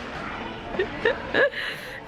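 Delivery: a sudden high, airy giggle; a teary, embarrassed little giggle. Real, not acted. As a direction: laugh it off, breathless and high. Tearful mother laughing at herself.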